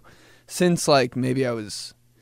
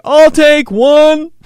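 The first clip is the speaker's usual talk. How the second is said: loud slow order; 14 semitones higher, 14 dB louder, much slower than usual. Retold or imitated. Mom ordering to trash can.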